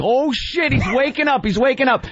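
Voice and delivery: high, hurried mock alarm as the horse stirs; mock panic. Jimmy fakes alarm to the buyer, high mock panic. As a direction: fake a startled handler, pitched high. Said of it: "Jimmy's like".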